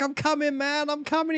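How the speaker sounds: high, hurried reply; high-pitched mock reassurance on the way; friend answering. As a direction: call back in a high voice. Retold advert, friend answering.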